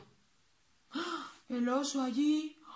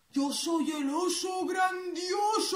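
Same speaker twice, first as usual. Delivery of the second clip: booming bear voice; 4 semitones higher, slower than usual. Acted. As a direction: announce yourself as a grand bear. Bear proud introduction.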